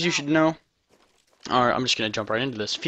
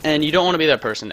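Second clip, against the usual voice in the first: ordinary talk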